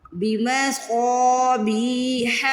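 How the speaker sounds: بمصابیح with a heavy, stretched saad. Shown as a demonstration. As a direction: recite بمصابیح very slowly, each long vowel drawn out. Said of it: "پہلے صاد کو stretch کر لیں". (بمصابیح میں صاد کو موٹا اور کھینچ کر)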